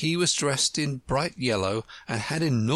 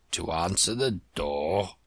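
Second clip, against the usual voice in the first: put-on old frog; 6 semitones lower than usual.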